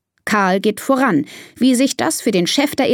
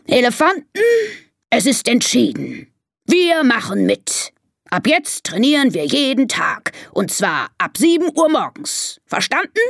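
lightly voiced herd boss, raised pitch; commanding elephant chief; 5 semitones higher than usual. Elephant chief ordering his herd to train, mildly commanding. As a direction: announce the decision firmly.

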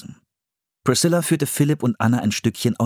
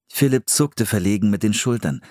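ordinary delivery, nothing marked

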